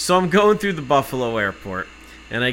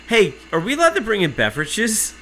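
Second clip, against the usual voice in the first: higher-pitched teasing inquiry; mock-innocent question; 8 semitones higher than usual. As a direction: ask it with feigned innocence. Needling the repetitive guard.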